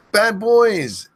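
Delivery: high, slow yelled name; screamed drawn-out name. Acted. Streamer screaming a viewer's name, slow yell. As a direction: stretch the name into a long yell. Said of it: "I end up screaming too much".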